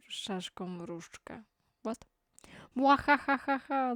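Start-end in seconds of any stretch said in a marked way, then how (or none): 2.7-3.9 higher, louder gloating evil cackle into a boast; villain laugh. Acted. Holding the stolen wand, the villain gloats with a cackle. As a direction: cackle like a cartoon villain who finally won.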